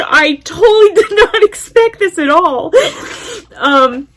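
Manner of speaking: overwhelmed, half-sobbing, half-laughing; laughing through tears. Real, not acted. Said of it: "this crazy cat lady crying".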